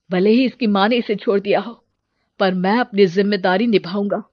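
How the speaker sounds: ordinary delivery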